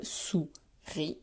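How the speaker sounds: SOURIS in two drawn-out parts, sou, then ris; split into syllables. Shown as a demonstration. Teacher showing two syllables in SOURIS, split syllable by syllable. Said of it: "deux syllabes".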